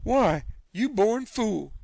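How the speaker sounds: slow, high contempt; an aunt glaring at a fool; scornful scolding. Acted. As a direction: drag out the insult.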